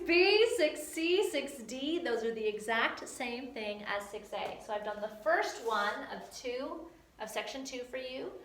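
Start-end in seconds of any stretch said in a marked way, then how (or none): none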